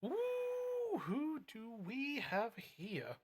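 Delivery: high, slow teasing ooh; high sing-song. Real, not acted. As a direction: draw it out, playfully curious. Greeting new chatter playfully.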